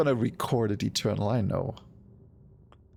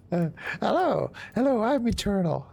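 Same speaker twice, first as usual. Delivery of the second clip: high-pitched mock impersonation; Eternal; 7 semitones higher than usual. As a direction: put on a silly, raised voice. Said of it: "does not sound like Eternal".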